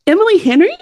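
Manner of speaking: high, questioning gasp of a name; surprised recognition. Retold or imitated. Fan spotting her author across the bakery, high surprised call.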